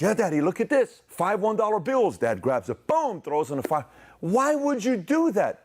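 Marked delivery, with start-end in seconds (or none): 0.0-2.2 put-on much higher, louder proud child; excited kid. Son proudly showing money. 4.2-5.6 much higher, slow, louder wail of protest; upset kid. Retold or imitated. Son upset money burned.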